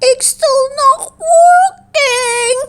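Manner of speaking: high, slow and sighing. A narrator's reading. Ava tells her friends the cure failed, sighing sadly. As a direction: let the disappointment sigh out. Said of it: "sighed Ava".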